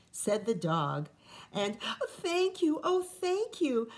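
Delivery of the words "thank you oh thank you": grateful witch cry. Acted. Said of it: "the grateful witch cried".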